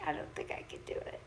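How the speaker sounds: hushed whisper of defeat; whispered quote. Retold or imitated. Whispering to herself that she can't go on. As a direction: whisper it like you're giving up.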